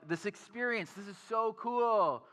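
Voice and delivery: high, eager, rushing the end; gush, then race ahead to the next one; excited about the next thing; excited already planning. Retold or imitated.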